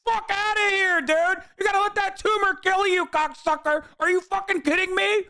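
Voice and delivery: high-pitched furious ranting at the screen; angry rant. Real, not acted. Ranting at a video he hates.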